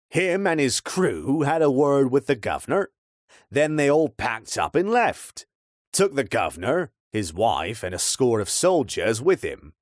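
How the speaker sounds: put-on weathered old local telling gossip; old man's voice. Old man tells of crew leaving.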